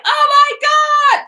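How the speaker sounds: mock panicked cry, loud, slow and high; hammed-up high, loud panic; panicked New Yorker. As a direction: wail it high and drawn out, imitating New York panic. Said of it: "everybody's in this sense of panic".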